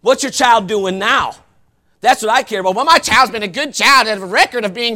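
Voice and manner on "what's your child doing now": ordinary talk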